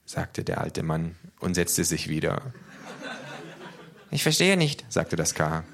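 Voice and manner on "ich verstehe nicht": high-pitched character voice; K, small creature voice. A narrator's reading. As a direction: say it high up, as little K. (hohe Figurenstimme)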